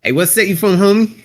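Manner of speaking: half-hearted, non-threatening L.A. challenge; unconvincing L.A. tough talk; L.A. accent. Shown as a demonstration. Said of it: "Give me L A".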